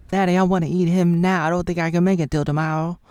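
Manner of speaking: begging her dad to let her feed, loud; hungry plea. Pretend. Vampire daughter pleads to dad.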